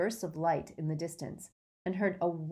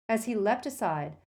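ordinary delivery, nothing marked

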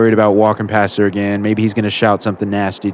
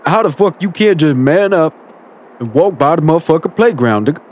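tough guy voice; 8 semitones higher than usual. Acted. Bully voice berating caller.

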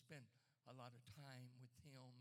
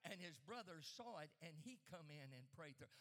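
preaching loud; 3 semitones higher, 9 dB louder than usual. Real, not acted. Preacher builds to shouting testimony.

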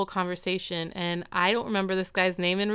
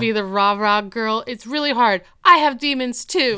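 loud rant climbing higher to an outburst; mock freak-out; 3 semitones higher, 7 dB louder than usual. Topanga cracks under being the cheerful one, building mock rant. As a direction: let the exasperation build to a mock yell. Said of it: "She freaks out".

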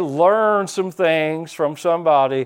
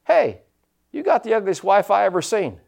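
parrot voice; 6 semitones higher than usual. Retold or imitated. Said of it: "that parrot goes".

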